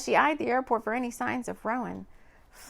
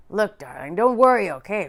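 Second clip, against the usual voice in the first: reassuring man; 7 dB louder than usual. A narrator's reading. Rowan reassuring on phone.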